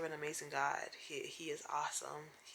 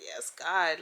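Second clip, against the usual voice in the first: loud call back to the preacher; retold church shout; 9 dB louder than usual. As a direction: shout it like answering a sermon. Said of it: "and I was like".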